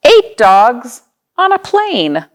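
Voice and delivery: normal talking voice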